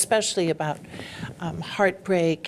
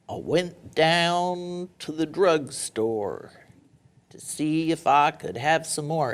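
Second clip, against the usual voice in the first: cowboy poetry; slower than usual. Shown as a demonstration. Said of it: "Like that three stanza thing?".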